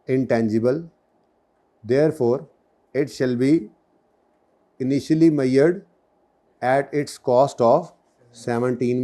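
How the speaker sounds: very slow, phrase by phrase with pauses; slow dictation. Shown as a demonstration. Teacher dictating an exam answer, very slow. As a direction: dictate it so students can write along. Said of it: "आराम आराम से मैं बोल रहा हूँ".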